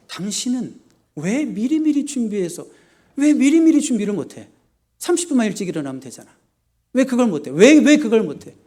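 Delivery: high, loud exasperated scolding; husband nagging his wife for being late. Acted. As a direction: berate her for always being late.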